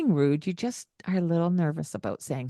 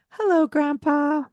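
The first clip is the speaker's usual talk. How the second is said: much higher greeting to grandpa; sing-song hello; 10 semitones higher, 3 dB louder than usual. Shown as a demonstration. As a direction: model the hello for your children. Modeling greeting at the door.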